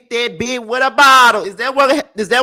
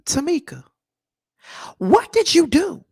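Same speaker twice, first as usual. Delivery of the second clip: usual speaking voice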